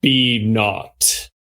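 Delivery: low, weighty pronouncement; a slow, deep command from beyond; booming otherworldly voice. Acted. Game master voices a chamber-shaking power, low booming command. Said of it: "a voice shakes the entire chamber".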